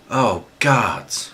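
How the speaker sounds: loud, high despairing cry; anguished cry. A narrator's reading. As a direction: cry out over a dying friend. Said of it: "Jean cried".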